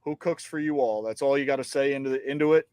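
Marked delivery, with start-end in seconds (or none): 0.0-1.0 soft demo of the barred owl rhythm; owl hoot cadence. Shown as a demonstration. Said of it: "Follow that cadence".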